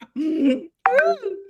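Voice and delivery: real burst of high, giggling laughter; helpless laughter. Real, not acted. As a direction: crack up uncontrollably. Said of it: "I'm laughing so hard".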